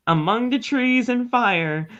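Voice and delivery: lightly hammed-up line from the notes; scripted flourish. Host reading the odd wording in the notes, mildly theatrical. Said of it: "have to say it like that".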